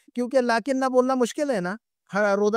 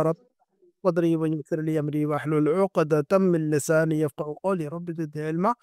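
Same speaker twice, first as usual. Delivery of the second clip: slow, measured Quranic recitation; recited prayer; 4 semitones lower than usual. Acted. Teacher opening the lesson with a dua, slow recitation. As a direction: intone the dua slowly before class.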